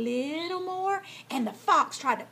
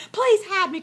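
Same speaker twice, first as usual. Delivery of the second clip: high, loud frightened plea; crying out for help; 7 semitones higher, 6 dB louder than usual. A narrator's reading. Fox fears the hunting dogs, crying out. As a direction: beg urgently to be hidden. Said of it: "cried the fox".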